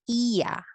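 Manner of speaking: iya said as two syllables, i then ya, stress on the i; Spanish hiatus demo. Shown as a demonstration. Teacher shows students Spanish hiatus with the stressed i. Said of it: "This is now pronounced as iya".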